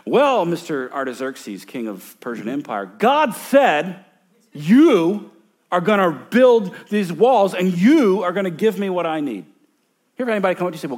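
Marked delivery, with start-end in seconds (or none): 0.0-9.4 mock arrogant, loud and pushy; arrogant demand. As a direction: puff it up like a cocky subject. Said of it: "he didn't say".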